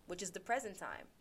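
ordinary delivery

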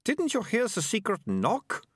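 put-on high, slow, exasperated girl; irritated girl, words drawn out; annoyed. A narrator's reading. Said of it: "staring up at him in annoyance".

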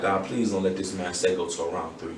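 hushed, pleading prayer under the breath; whispered prayer. Retold or imitated. Praying to God before his round, hushed plea. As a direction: murmur a desperate plea to God. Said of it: "I was praying to God".

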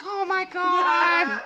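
high, slow wail; crying family member. Retold or imitated. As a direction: wail it out in horror. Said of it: "Your family's crying".

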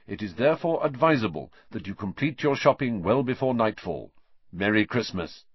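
pompous bureaucratic announcement; official notice. A narrator's reading. Ron reading the Ministry notice on the door, formal.

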